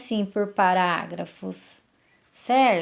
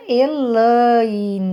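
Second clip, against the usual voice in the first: "Elaine" drawn out slowly, syllable by syllable; slow syllable-split "Elaine"; 4 dB louder than usual. Shown as a demonstration. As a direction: stretch each syllable of "Elaine" to show the split. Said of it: "olha só a separação de sílaba".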